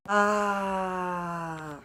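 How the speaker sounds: held "aaah", slowly falling; long drawn-out "ah". Real, not acted. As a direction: let out one long, sustained ah. Long cri isolé après une phrase. (long « aaah » tenu)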